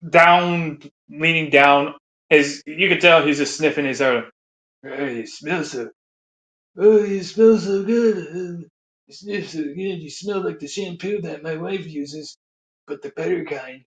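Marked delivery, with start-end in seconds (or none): 4.8-13.8 put-on creepy old man. Biden sniffing girl, creepy.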